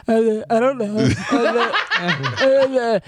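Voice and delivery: dopey, dragged-out mumbling grunts; mock boyfriend voice. Woman mocking how her boyfriend sounds, dopey droning voice. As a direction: imitate a clueless boyfriend droning uh. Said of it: "this is how my boyfriend sounds to me".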